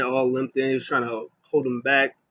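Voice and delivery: usual speaking voice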